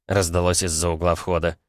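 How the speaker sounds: ordinary delivery, nothing marked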